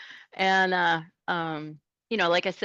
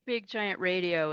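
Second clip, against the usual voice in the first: normal talking voice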